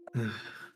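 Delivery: soft breathy sigh in character; weary sigh. Acted. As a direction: let out a quiet sigh. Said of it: "Zane just sighs".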